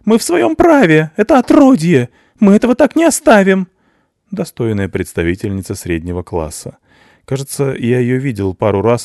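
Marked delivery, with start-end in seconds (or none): 0.1-3.7 screaming woman. A narrator's reading. Mother screams in outrage at the officer, very high and loud.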